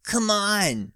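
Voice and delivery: high, pleading whine. A narrator's reading. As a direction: beg them to untie him. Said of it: "Jake pleads".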